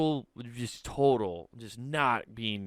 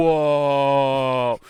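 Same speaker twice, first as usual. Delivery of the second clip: loud stunned crowd reaction; imitating the whole room's surprise; shocked whoa; 8 dB louder than usual. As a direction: gasp it out in shock. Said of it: "was like".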